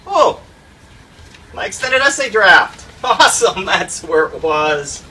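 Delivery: high, loud, laughing relief; thrilled to find it; excited discovery. Real, not acted. Finds lost essay draft.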